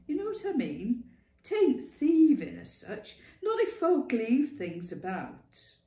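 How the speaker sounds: put-on a stern old Lancashire mother, emphatic; blunt old woman. Ma warning Clara she needs her wits, loud. Ma Duckworth voiced in story.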